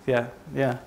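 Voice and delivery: usual speaking voice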